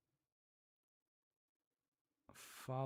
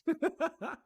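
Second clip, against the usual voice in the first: bright high laugh bursting out; high burst of laughter; 10 dB louder than usual. Real, not acted. Student laughing loudly at her own confusion. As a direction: laugh high at the odd word.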